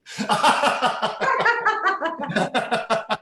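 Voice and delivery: big hearty laugh. Shown as a demonstration. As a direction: let the laugh burst out. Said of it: "and go".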